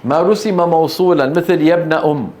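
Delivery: ordinary talk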